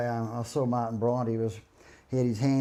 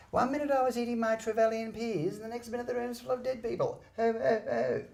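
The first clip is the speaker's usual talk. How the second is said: much higher childlike mimicry; imitating Martin Bryant; 12 semitones higher than usual. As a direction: play him as a meek little boy.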